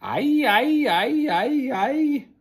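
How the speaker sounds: long high wailing howl; loud, high creature howl. Acted. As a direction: howl at the sky like a wild beast. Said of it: "Boone lifted his head and cried out".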